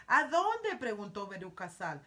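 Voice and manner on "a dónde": pregunta ansiosa. Acted. Veruca eagerly asks where.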